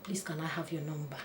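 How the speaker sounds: retold calmed woman, soft; quiet plea. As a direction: ask gently after calming. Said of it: "she became quiet".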